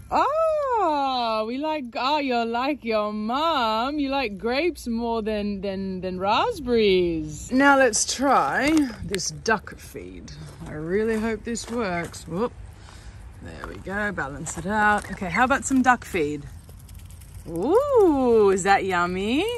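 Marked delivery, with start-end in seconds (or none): 0.1-7.5 tender to ducklings. Real, not acted. Duck mum praising ducklings eating grapes, tender. 17.5-19.4 coaxing. Real, not acted. Duck mum asking ducklings about feed, coaxing.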